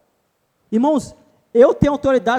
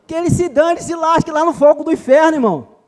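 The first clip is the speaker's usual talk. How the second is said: louder and higher, spitting it out; fiery preaching; 5 semitones higher, 6 dB louder than usual. Real, not acted. Preacher to congregation, condemning the devil with fiery contempt.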